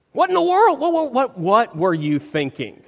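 hammed-up "how could you" outburst; mock incredulous scolding. Preacher pretending Jesus berates Peter, mock incredulous scolding. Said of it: "At no point do we see Jesus going".

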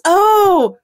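high, loud, long dawning "oh"; mock realisation. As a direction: act out the penny dropping.

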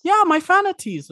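high, loud proud squeal; proud crab. Acted. Crab proudly repeats word.

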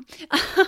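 bright loud burst of laughter. Real, not acted. Mom laughs at her own rambling, loud laugh. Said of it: "I just talk".

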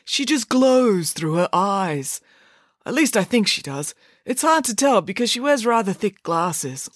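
put-on high-pitched, smitten boy's voice; besotted, earnest brother; lovestruck boy. A narrator's reading. Story reader voices lovestruck Binky.

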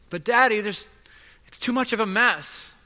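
a hint of a whining six-year-old; mock childish protest, trailing into a laugh; little girl's whine. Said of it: "And I go into her room and I say".

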